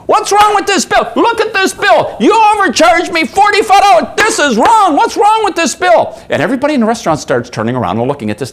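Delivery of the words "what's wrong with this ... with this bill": high-pitched imitation of an irate customer; mock screaming. German tourist screaming at waitress over his bill, imitated high yelling. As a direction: yell it high, hammering each complaint. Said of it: "starts just about screaming at Virginia".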